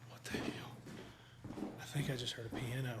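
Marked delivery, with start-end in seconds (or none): 0.1-0.7 startled breathy whisper. Real, not acted. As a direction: whisper it in alarm. Spooked in empty building.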